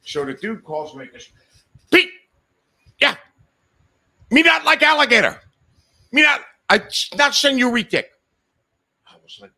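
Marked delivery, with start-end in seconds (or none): 1.9-8.1 high, loud broken-English complaint; irate foreign buyer. Retold or imitated. Buyer phones seller to refuse the deal, high irate shouting.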